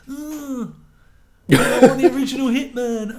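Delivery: high, whining complaint with a laugh; whiny fan voice. Retold or imitated. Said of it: "everyone's gonna say".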